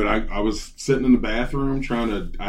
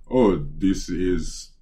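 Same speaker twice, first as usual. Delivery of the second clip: mock a formal tech agent with an Indian accent; halting, put-on Indian accent; imitated Indian accent; 4 semitones lower than usual. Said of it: "Dude had an Indian accent".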